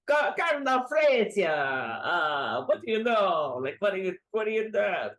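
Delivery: mock French general; hammed-up French accent; bad French accent. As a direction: put on a terrible French accent. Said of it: "bad French accent".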